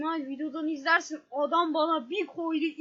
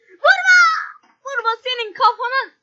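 panicked shout; 6 semitones higher, 9 dB louder than usual. Real, not acted. Panicked yelling in game.

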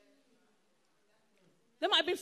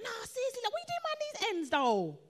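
high-pitched imitation of an astonished mate; incredulous street friend; 6 semitones higher than usual. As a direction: call it out in mock disbelief. Street friend questions her loudly.

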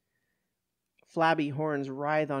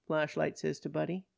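plain reading voice

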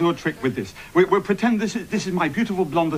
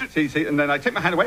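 usual speaking voice

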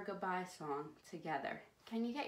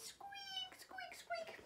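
very high, quiet little squeaks; mouse squeak; 11 dB quieter, slower than usual. Pretend. Host voicing the little mouse for kids, high soft squeaks. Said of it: "and he says".

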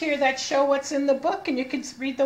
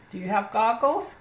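ordinary talk, nothing marked

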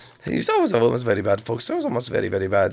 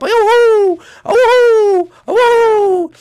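Mario whoop; 6 dB louder, much slower than usual. Acted. Mario jump yells.

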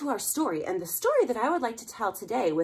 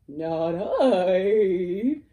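horse voice; 8 dB louder than usual. Acted. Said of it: "said the horse".

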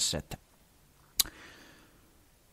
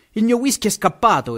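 sobbing exclaim; 7 semitones higher than usual. A narrator's reading. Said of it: "esclamò singhiozzando".

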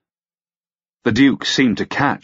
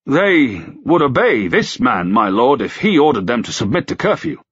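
tentative; 6 semitones higher, 3 dB louder than usual. A narrator's reading. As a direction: feel your way gingerly into the proposal. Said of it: "the constable said tentatively".

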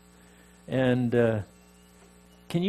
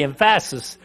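"emphasis" jokingly stressed on its middle, em-PHA-sis; wrong-syllable stress; 3 dB louder than usual. Shown as a demonstration. Preacher joking about his scripture reading, "emphasis" as em-PHA-sis. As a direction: lean hard on the second syllable of "emphasis". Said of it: "whether it's on the right syllable or not".

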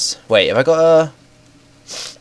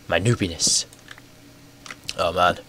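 usual speaking voice

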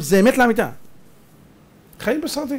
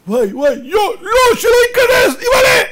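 scared viewer; 7 dB louder than usual. Retold or imitated. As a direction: shriek in fear at the screen. Viewers scared during film.

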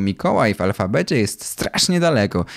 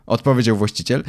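ordinary delivery, nothing marked

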